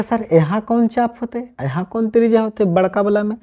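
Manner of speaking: lightly mimicked student question; imitating a puzzled student. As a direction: play the confused pupil asking sir. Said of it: "अब कहेगा".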